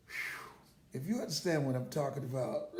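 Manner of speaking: ordinary delivery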